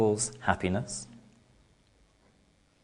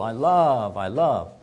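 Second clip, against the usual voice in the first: light Spanish accent, lightly put on; a playful hint of a Latin lover's Spanish accent. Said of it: "Sounding a bit Spanish now".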